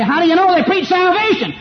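high-pitched mock objection; challenging objector. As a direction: put on a high, incredulous heckler's voice. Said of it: "You say".

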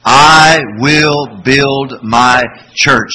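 very slow, loud chant; slow group recitation again. Shown as a demonstration. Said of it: "Say it again".

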